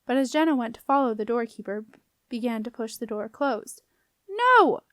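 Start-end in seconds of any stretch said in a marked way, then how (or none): 4.3-4.8 high, loud cry of protest; urgent full-voiced shout; yell. A narrator's reading. Boy seeing the door closed on the girl, yelling. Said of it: "yelled Beetle".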